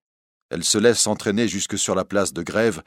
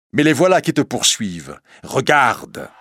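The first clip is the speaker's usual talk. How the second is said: raised, pressing alarm; urgent warning; 5 semitones higher, 5 dB louder than usual. Acted. Priest warning the bohémienne of pursuers, urgent. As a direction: warn urgently, lifting the voice.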